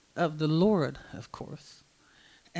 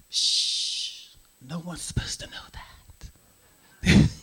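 hushed secret whisper. Pretend. To the congregation, a secret kept in a whisper. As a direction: breathe it like a secret.

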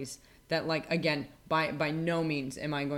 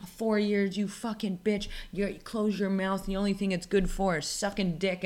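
mocking troll voice; 4 semitones higher than usual. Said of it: "they were like".

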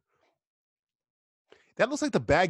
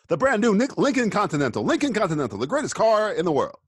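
car ad announcer; 7 semitones higher, 4 dB louder than usual. Pretend. Mock car advert.

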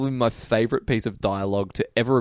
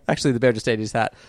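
ordinary talk

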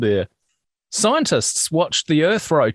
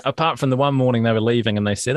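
ordinary talk

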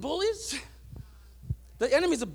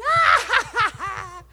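higher, laughing taunt of a bully brother; mocking laugh; 8 semitones higher, 3 dB louder, much slower than usual. Older brother taunting his little brother in the pool, laughing mockery. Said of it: "And he would be laughing".

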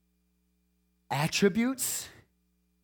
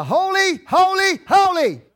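high, loud preacher's proclamation; crying out; 10 semitones higher, 4 dB louder than usual. Retold or imitated. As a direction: cry each word out high and ringing. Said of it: "but they cry".

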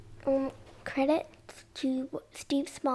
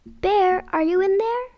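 loud, higher call into a hiding place; 7 semitones higher, 9 dB louder than usual. Acted. Friends calling for a hidden bear, loud and high. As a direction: shout out to find him. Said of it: "They shouted".

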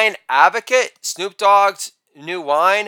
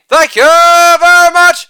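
high, loud, sustained catchphrase; showman's ringing thank-you; showy sign-off; 10 semitones higher, 8 dB louder than usual. Acted. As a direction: belt it out on one held high note.

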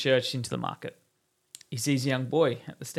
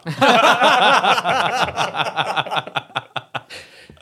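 big laugh; 8 semitones higher, 10 dB louder than usual. Real, not acted. Punchline laugh after joke.

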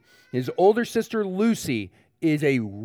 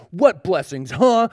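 acted angry bully's comeback; snapping back; 3 semitones higher, 7 dB louder, slower than usual. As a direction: throw it back as a challenge, rising to a punchy "huh". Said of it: "she snaps back at him".

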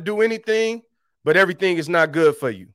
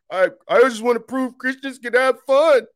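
higher, put-on whiny voice; 6 semitones higher than usual. Pastor parodying immature believers who want to prove fun. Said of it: "are we gonna graduate from the".

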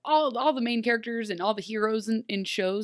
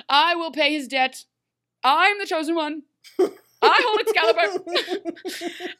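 put-on haughty, drunk on power; 8 semitones higher, 5 dB louder than usual. Snow proclaiming to Rumple, haughty and drunk on power. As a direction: ham up a queen drunk on her own power. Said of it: "haughty and just drunk on power".

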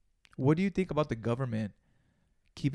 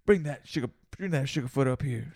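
movie villain voice; 3 semitones higher, 5 dB louder than usual. Retold or imitated. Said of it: "Some shit like that".

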